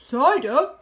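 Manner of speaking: loud exclamation with a lift; keen exclamation. A narrator's reading. Badger hearing of cider, loud keen exclamation. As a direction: say it loudly, lifting at the end.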